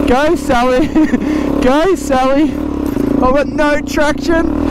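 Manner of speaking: high, laughing cheer to the bike; laughing encouragement mid-climb. Real, not acted. Urging bike up the hill, laughing.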